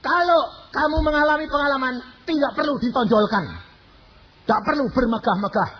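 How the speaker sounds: high, loud preaching voice of another pastor; another preacher. Retold or imitated. Quoting a fellow pastor's sermon, high and firm. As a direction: quote the rival sermon firmly. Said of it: "Khotbah hamba Tuhan".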